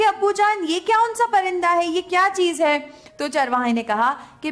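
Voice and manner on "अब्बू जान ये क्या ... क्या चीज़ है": put-on high-pitched questioning little boy; higher, wondering kid's voice; curious child. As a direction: ask Dad eagerly in a raised child's voice. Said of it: "जो बच्चा था चरवाहे का, वो पूछने लगा".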